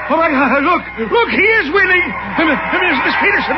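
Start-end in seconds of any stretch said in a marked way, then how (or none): 0.1-2.1 excited disbelief. Acted. Clyde sees horse winning.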